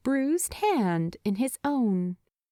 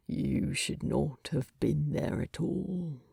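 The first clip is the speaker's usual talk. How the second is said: lowered, drowsy reproach; half-asleep anger; 5 semitones lower, 5 dB quieter than usual. Acted. Half-asleep Thorin scolding Bilbo, low and quiet. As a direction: grumble it, angry but barely awake. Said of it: "sound angry when he's half asleep".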